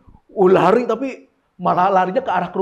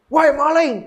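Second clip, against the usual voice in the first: loud, higher alarm call; acted shout; 7 semitones higher, 6 dB louder, slower than usual. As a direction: yell out as if spotting a thief. (teriakan memergoki maling)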